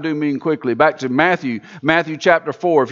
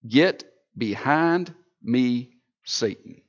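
slow, low, soft, the last word whispered; stern quiet rebuke; 6 semitones lower, 6 dB quieter, slower than usual. Acted. Jesus rebuking Peter, slow and low. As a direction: hold it back and hiss it low.

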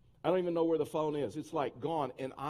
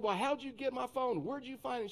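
indignant questioning; 8 semitones higher than usual. Retold or imitated. Retold angry questioning of the girl.

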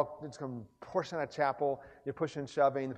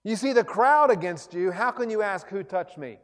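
Peter's protest; 6 semitones higher, 12 dB louder than usual. Retold or imitated. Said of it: "Peter ... who said".

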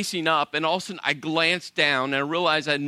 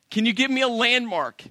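higher-pitched exasperated plea, re-enacted; 7 semitones higher, 3 dB louder than usual. Husband pressing lost wife for her location, exasperated. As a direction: press for an answer, mock patience running out.

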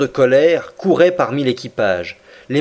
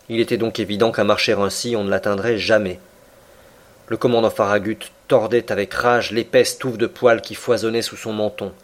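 plain reading voice

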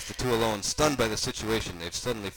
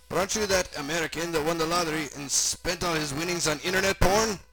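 put-on raised, louder German-accented voice; eager German oddball asking, pitched up; German stranger character; 8 semitones higher, 4 dB louder than usual. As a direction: play an inquisitive German character.

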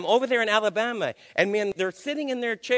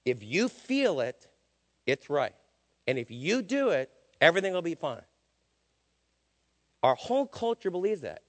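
ordinary talk, nothing marked

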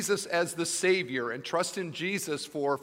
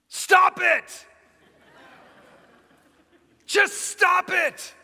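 explosive shout, much higher and louder, second line slow; deadpan therapist suddenly yelling; 8 dB louder, much slower than usual. Retold or imitated. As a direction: blast it at the patient out of nowhere. Psychiatrist yells at patient.

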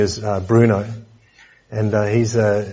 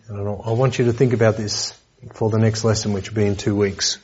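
ordinary talk

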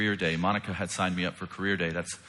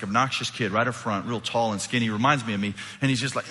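ordinary delivery

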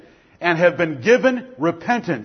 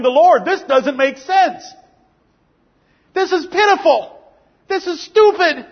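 prodigal son's lament; 4 semitones higher than usual. Acted. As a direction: cry it out as the broken son. Preacher voices prodigal's lament.

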